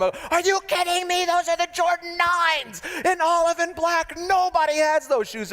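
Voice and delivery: high, incredulous shoe-lover outburst; excited self-quote. Retold or imitated. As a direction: gush in disbelief over rare Jordans. Pastor voicing his own excitement.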